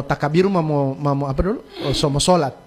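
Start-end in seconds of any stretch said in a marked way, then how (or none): none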